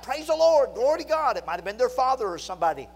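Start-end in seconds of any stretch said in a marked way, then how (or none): 0.0-1.4 high, loud shouts of praise; raised voice, imitating children's praise; children crying out. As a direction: cry it out like excited children. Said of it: "the children cry out".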